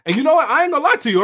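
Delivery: emphatic outburst. Real, not acted. Emphatic personal confession.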